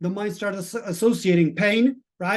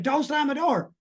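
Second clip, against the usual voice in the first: high, sharp mock yell; retold scream; 8 semitones higher than usual. At his family over a slammed door, a high mock yell. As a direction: snap it out the way he used to lose it. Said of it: "I would just lose it to a scream".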